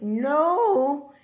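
loud, high no of dismissal; emphatic no. Real, not acted. Rejecting the productivity excuse, emphatic. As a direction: shut the idea down.

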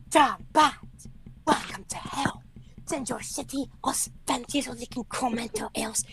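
put-on high, strained mousy voice with an odd accent. Host re-enacting his rival's diss track, squeaky mocking imitation. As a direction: imitate a mouse-voiced rival delivering threats. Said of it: "His diss track was like".

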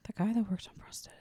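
hushed, fast whispered repeat; breathless mock-conspiratorial whisper. Real, not acted. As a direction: whisper it like a secret.

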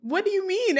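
high-pitched, startled question; mock bewilderment. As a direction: imitate being caught totally off guard. Acting out his startled reaction.

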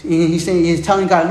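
normal talking voice

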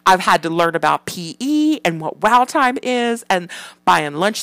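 put-on loud, high-pitched ranting kid voice; imitated son's meltdown. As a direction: mimic a five-year-old losing it after school. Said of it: "he just loses his shit".

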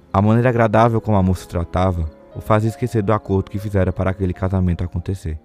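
usual speaking voice

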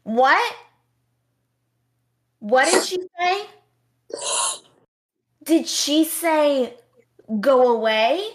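put-on loud, high, slowed old-lady voice, straining to hear; hammed-up confused old woman asking too loud; confused elderly moviegoer. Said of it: "one of the people in the couple would be like".

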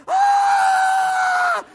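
loud, high shriek of terror; mock scream, full throat; imitated scream. Preacher imitates the olive-phobic woman screaming, high and loud. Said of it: "goes screaming and running off".

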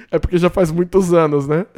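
loud, laughing admission. Real, not acted. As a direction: say it through a laugh, loudly. Amused at his own mistake. (rindo, em voz alta)

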